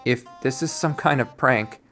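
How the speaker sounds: ordinary talk, nothing marked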